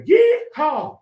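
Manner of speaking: high, loud whooping yeehaw; yeehaw cheer. Acted. Hype cheer for Battlehawks.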